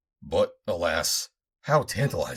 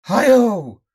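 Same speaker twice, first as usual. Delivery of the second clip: high, loud cackling cry; cackling exclamation; mad cackle; 13 dB louder than usual. Acted. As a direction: let out a mad old man's cackle. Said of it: "cackled Fortock".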